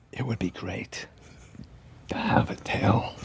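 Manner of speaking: slow hushed whisper up close. Retold or imitated. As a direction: lean in and breathe it, slowly. Walken leans close, says it.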